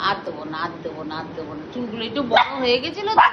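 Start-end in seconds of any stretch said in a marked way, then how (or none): 0.1-1.6 low, soft reassurance; hushed low comfort; soothing promise. Real, not acted. Soothing a crying child after haircut.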